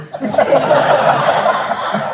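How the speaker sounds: loud unvoiced laugh burst. Real, not acted. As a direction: break into a breathy laugh. Laughing at own story.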